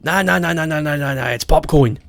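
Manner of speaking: rattled-off Australian "nah, nah", sliding down; Australian accent. Retold or imitated. Australian guy convincing a student, insistent Australian accent. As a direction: imitate an insistent Australian, falling away. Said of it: "I remember an Australian guy".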